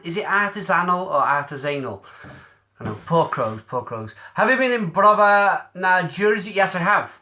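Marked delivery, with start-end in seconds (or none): 3.6-4.1 softer, lower mock sympathy; mock pity. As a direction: coo over the poor crows, softly. Pitying crows on a can.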